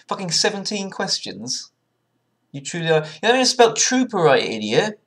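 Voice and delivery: loud, high furious rant at the screen; angry yelling. Real, not acted. Yelling at the quiz site over a misspelled trooper, furious. Raging at quiz site's message.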